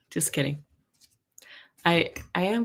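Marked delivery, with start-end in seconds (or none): 0.1-0.6 soft whispered throwaway; whispered aside. Real, not acted. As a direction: drop to a whisper for the joke. Whispered aside after a joke.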